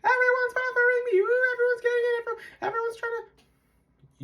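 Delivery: very high-pitched mock whine; victim mentality. YouTuber mimicking people who blame everyone, mock whiny victim. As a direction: wail it as a hammed-up self-pitying victim. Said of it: "some people will have a victim mentality".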